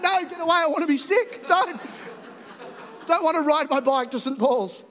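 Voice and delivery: put-on high, whiny childish protest; whiny kid protest. A kid whining at mum to stop praying. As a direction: protest like a sulky kid dodging prayer.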